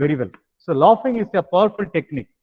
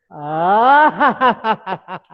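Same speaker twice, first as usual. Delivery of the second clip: laughing ah; 2 semitones higher than usual. Shown as a demonstration. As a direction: laugh on an open ah.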